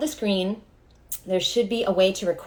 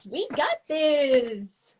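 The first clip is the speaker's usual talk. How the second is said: higher, very slow, with a different tone; slow, lifted chant of encouragement; stretched-out sing-song; 5 semitones higher, much slower than usual. Shown as a demonstration. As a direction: sing it out long and airy.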